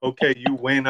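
plain reading voice

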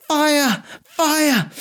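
high-pitched distant cry of alarm; shout of alarm. Acted. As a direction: call it out high and drawn, no extra volume. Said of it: "suddenly a shout came from outside".

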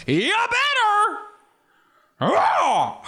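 very high, drawn-out threatening yell; Al Pacino. Acted. Pacino warning his kid, over-the-top yell. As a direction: bellow a warning, hammed up. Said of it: "imagine being Pacino's kids".

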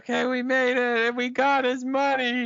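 playing the giddy survivors; mock triumphant. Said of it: "and they're like".

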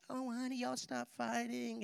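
put-on calm the kids down gently like a mom; soothing mom. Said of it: "Mom comes to the rescue and says".

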